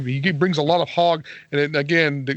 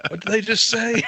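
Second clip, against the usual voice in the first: higher, laughing mock confusion; laughing question; 8 semitones higher than usual. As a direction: ask it through laughter. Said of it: "Robert would ask me to translate".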